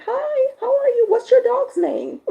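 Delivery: put-on high, loud, eager little-kid greeting; five-year-old kid. Five-year-old son greeting strangers on the street, high kid voice. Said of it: "my five-year-old now".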